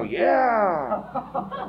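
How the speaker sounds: high, breathy-eyed yeah with a laugh; wide-eyed young guy. Retold or imitated. Young men answering Charlie, high mock yeah. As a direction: mimic the scared young men.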